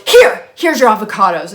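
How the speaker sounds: loud, curt mock roommate handing them back; snapping loudly. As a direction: throw the line at her, irritated and loud. Roommate angrily hands back avocados.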